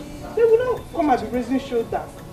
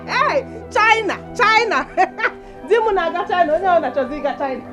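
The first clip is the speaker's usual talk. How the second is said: gloating laugh; 7 semitones higher, 4 dB louder than usual. Acted. Wife gloating about China.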